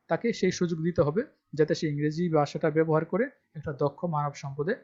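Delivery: ordinary talk, nothing marked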